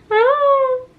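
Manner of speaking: very high, loud tearful sigh. Retold or imitated. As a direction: melt over the baby. Baby's soft face.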